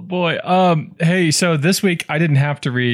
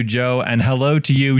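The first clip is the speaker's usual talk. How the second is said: usual speaking voice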